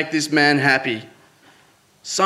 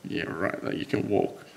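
whispered, much softer disbelief; shocked whisper; 6 semitones lower, 13 dB quieter than usual. Retold or imitated. Son whispering back to his dying dad in disbelief. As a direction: breathe it out in stunned disbelief. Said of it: "I was like".

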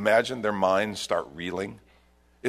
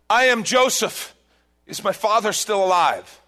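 loud reveal; 9 semitones higher, 6 dB louder than usual. Acted. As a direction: announce yourself to the brothers. Joseph reveals himself to brothers.

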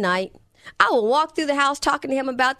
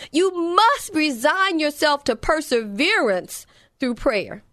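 emphatic, raised-pitch sermon delivery; forceful preaching; 4 semitones higher, 4 dB louder than usual. Real, not acted. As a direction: drive each point home to the congregation. Preaches with force before telling story.

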